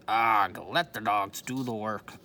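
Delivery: a touch of a growling pirate, loud; growled. A narrator's reading. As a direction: grumble it out like a surly captain. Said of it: "growled Captain Bones".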